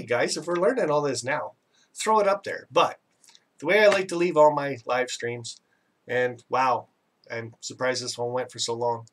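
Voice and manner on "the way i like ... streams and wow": normal talking voice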